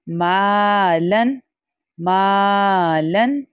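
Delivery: मालन slow, मा held long; stretched maa. Shown as a demonstration. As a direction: draw out the मा. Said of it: "Again अलिफ मद्दा". (मालन में मा लंबा)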